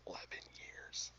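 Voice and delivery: "Eleven years." whispered, breath only; emphatic whisper. Real, not acted. Repeating for emphasis.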